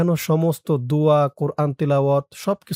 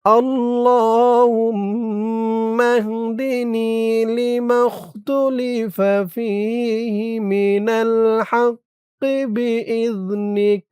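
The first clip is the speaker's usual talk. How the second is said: slow chanted dua for students to repeat, higher and slow; recite along; 5 semitones higher, much slower than usual. Shown as a demonstration. Teacher asks students to recite with him, slow chant. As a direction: lead the class in chanting the dua slowly. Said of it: "আমার সাথে বলুন".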